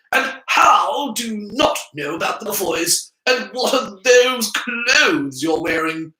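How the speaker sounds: high, slow, probing questions; sudden suspicion. A narrator's reading. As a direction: narrow your eyes and interrogate. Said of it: "with sudden suspicion".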